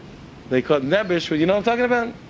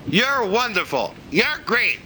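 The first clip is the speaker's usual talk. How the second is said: mock chorus praise; 5 semitones higher, much slower than usual. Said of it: "everyone in unison".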